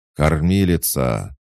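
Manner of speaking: long, drawn-out loud call. A narrator's reading. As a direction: call again for help, stretching it slowly and loudly. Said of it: "крикнул Карл громче".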